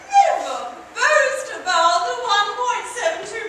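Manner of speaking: a hostess coyly denies boasting, high; squeaky denial. Acted. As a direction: shriek it in mock denial. Peacock mock-modest denial.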